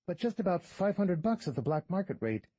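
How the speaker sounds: a smirking fixer naming his price; shady tour guide. Acted. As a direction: play the sly, haggling guide. Guide's voice, no speech tag.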